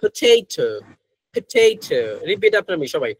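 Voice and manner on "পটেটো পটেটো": British পটেটো with puffed p and t; British accent. Shown as a demonstration. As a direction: slowly model British পটেটো, aspirated consonants. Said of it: "ব্রিটিশরা এটাকে বলে".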